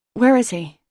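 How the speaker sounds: loud, pointed demand. A narrator's reading. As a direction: insist on an answer. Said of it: "I demand".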